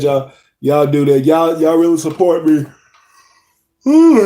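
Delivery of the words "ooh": surprised ooh. Real, not acted. Sudden exclaim.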